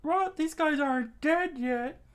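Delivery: put-on very high squeaky kid yell; screaming crowd of children. A narrator's reading. Children pelting bandits with stones, screaming. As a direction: shriek it in falsetto, loud. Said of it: "the crowd screamed".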